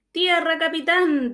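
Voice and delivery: slow, high shouted call of land; sailor shouting land. Acted. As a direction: call it out to the captain, drawn out. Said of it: "dijo Gómez".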